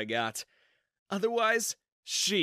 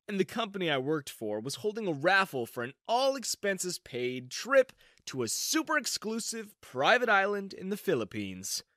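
usual speaking voice

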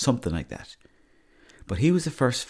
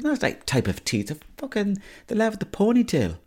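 exasperated high retort; 12 semitones higher than usual. Retold or imitated. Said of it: "I was like".

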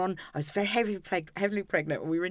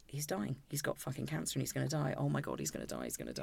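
panicked inner voice; 3 semitones lower, 10 dB quieter, faster than usual. Retold or imitated. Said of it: "I thought".

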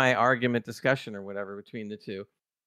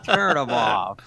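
a lightly put-on Tiana voice, a bit louder; imitating Tiana; 4 dB louder, much slower than usual. Host imitating Tiana ordering the protocols off, lightly voiced. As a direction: bark the order gleefully as Tiana. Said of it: "Just like".

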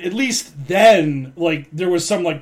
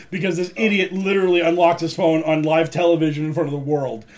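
ordinary talk

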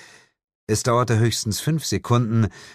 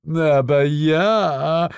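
very slow, drawn-out, higher coo; sweet cooing; 9 semitones higher, 5 dB louder, much slower than usual. A narrator's reading. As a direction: coo it ingratiatingly, stretching the vowel. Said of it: "säuselte Asmodes". (gedehnt gesäuselt)

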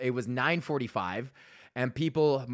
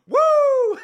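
loud high crowd whoop; excited whoop; 11 dB louder than usual. Retold or imitated. Crowd cheering at the show.

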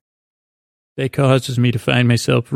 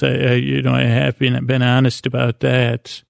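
ordinary delivery

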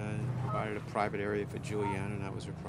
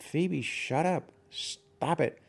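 higher, slow retold snap at the dog; impatient snap; 7 semitones higher, much slower than usual. Father retelling how he snapped at the barking dog, higher and slow. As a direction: replay the moment you lost patience with the barking dog. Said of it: "in my impatience".

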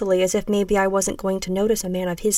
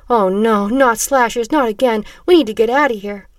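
put-on frightened boy, raised voice; high-pitched squeak; 3 semitones higher, 6 dB louder than usual. A narrator's reading. Small boy fearing the slashers, alarmed squeak. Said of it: "In a high-pitched voice, he squeaked out".

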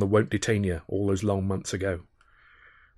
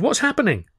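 1 alarmed question; 13 semitones higher than usual. A narrator's reading. Panicked exchange on the quay.

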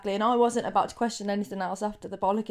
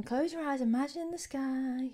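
soothing meditation voice; 3 semitones higher, 8 dB quieter than usual. Retold or imitated. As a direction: lull her gently toward sleep. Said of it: "sounds very relaxing".

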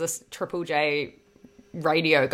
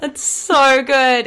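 laughing; 8 semitones higher, 10 dB louder, much slower than usual. Real, not acted. Laughing at the story.

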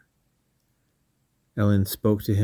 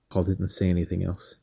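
ordinary delivery

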